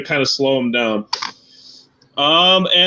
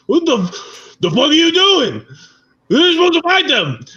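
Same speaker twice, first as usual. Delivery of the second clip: Slug yelling; 11 semitones higher than usual. Acted. GM voices Slug yelling at Machiavelli.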